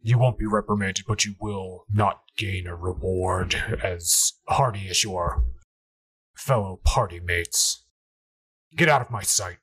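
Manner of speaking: measured, low dismissal from a displeased superior; stern older elf. Acted. Game master voices the older elf.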